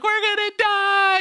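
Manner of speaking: very high, drawn-out mock scream; loud, slow wail of hammed-up panic; panicked scream. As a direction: shriek in comic terror. Rafters panic in the rapids.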